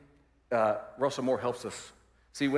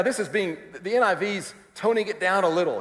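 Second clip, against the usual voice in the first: usual speaking voice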